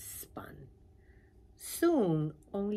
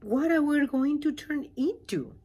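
high, loud little pollywog asking; a small eager creature, pitched up; 8 semitones higher, 10 dB louder than usual. Acted. Pollywog voiced in read story.

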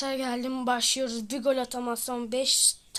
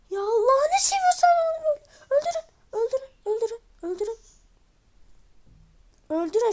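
high, crying plea to teammates; desperate pleading; 9 semitones higher, 5 dB louder, faster than usual. Real, not acted. Gamer begging teammates to kill the enemy, high and tearful. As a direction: beg them with a sob in the voice.

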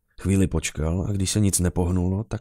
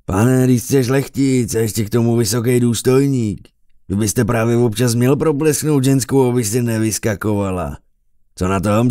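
loud, raised tavern harangue; rowdy bellowing; 4 semitones higher, 7 dB louder than usual. A narrator's reading. Drinking companion goading the noble officer, rowdy bellowing. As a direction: egg him on at full volume. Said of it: "Poslední větu zařval za doprovodu bouřlivého souhlasu".

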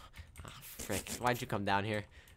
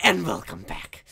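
louder, slow hammed intro; youtuber intro; 4 semitones higher, 7 dB louder, slower than usual. Shown as a demonstration. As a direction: drag out a goofy welcome. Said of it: "Let's try this again".

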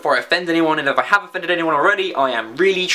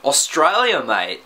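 broad mock Australian, dropping low at the end; Australian accent; 4 semitones lower, slower than usual. Shown as a demonstration. Vlogger demonstrating accents by country name, Australian accent. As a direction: put on a comic Australian accent. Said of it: "say in that accent is the name".

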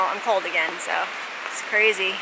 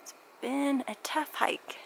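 exhausted whisper; 4 semitones higher, 9 dB quieter than usual. Real, not acted. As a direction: breathe the words out, spent. Exhausted on the snow field.

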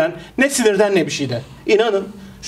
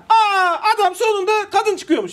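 mock spoiler tweet; 8 semitones higher than usual. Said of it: "oradan yazar".

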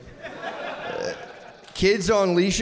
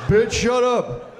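mock tough old lady; 5 semitones higher, much slower than usual. Acted quote of old lady abuser.

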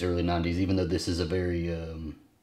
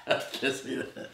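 laughing; 6 dB quieter, slower than usual. Real, not acted. Laughing after the take.

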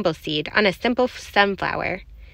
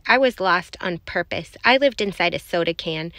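ordinary delivery

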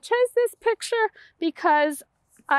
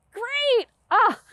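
excited praise; 8 semitones higher than usual. Real, not acted. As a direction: cheer the little one on. Praising child after song.

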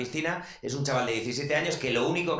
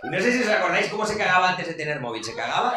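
ordinary talk, nothing marked

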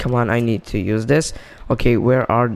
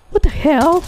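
outraged surprise; 4 dB louder than usual. Real, not acted. Gets blue loot instead of gold.

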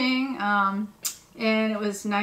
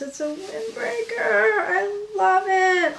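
high, delighted squeal; higher squealing "I love it" said slowly; excited; 9 semitones higher, 4 dB louder than usual. Real, not acted. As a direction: gush with real joy. Excited over windbreaker.

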